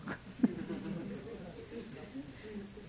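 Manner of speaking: long, soft chuckle; soft laugh. Real, not acted. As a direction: laugh softly and let it run on. Cười sau câu trả lời. (cười nhẹ kéo dài)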